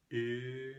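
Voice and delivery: "E" held long with the lips rounded; a long front E through rounded lips, like ö. Shown as a demonstration. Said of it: "just with your lips rounded".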